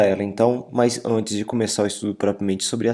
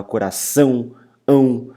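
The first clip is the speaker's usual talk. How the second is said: coração, then ão repeated alone, louder; stress on final syllable; 3 dB louder, slower than usual. Shown as a demonstration. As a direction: punch the ending ão of coração. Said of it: "pronunciado com muita intensidade".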